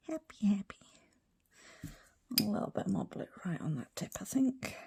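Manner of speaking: soft unvoiced whisper. Real, not acted. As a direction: murmur it under your breath. Whispered while working.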